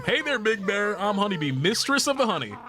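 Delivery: lightly voiced bee lady. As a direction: play her as a sweet, flirty bee.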